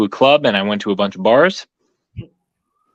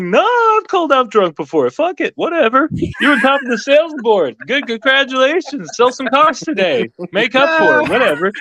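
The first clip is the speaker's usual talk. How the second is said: much higher mock boss voice; imitating the boss. Salesman imitating his general sales manager, high mock voice. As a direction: play the boss as a squeaky, amused pushover. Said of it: "He's like".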